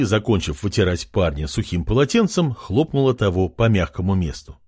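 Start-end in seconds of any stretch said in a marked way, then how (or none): none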